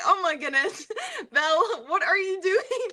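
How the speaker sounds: high-pitched, giggly mock reproach; amused, embarrassed exasperation at yourself; laughing self-scolding. Laughingly scolds her past self.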